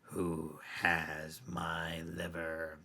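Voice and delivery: slow, drawn-out ghostly moan; a dead woman asks for her liver, very slowly; ghostly woman's voice. Acted. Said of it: "she heard a woman's voice".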